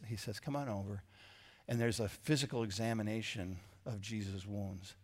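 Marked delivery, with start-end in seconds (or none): 0.4-1.0 soft, low, gentle invitation; gently. Retold or imitated. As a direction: welcome him in kindly. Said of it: "so gently, he just".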